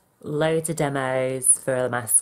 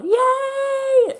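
high squealing cheer; cheerleader; 6 dB louder than usual. Real, not acted. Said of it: "Sound like a fucking cheerleader".

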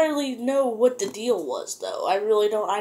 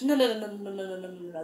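rattled-off doubt; 6 dB quieter, much faster than usual. Retold or imitated. Suspecting a catch.

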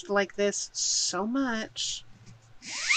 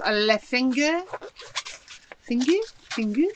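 put-on rusty French accent, stretching the word finger; mock French accent, a little out of practice; 3 semitones higher than usual. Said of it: "I used to be able to do the French accent".

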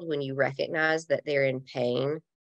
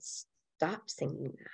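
very soft, drawn-out command; hushed retold order; 11 dB quieter, much slower than usual. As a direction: say it slowly, barely above a murmur. Retelling telling husband to stop.

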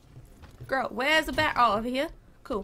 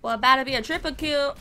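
ordinary delivery

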